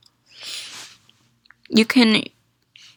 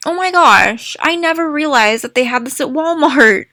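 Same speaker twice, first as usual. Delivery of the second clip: excited; 3 semitones higher, 4 dB louder than usual. Real, not acted. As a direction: gush with real surprise. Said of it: "I am freaking out right now".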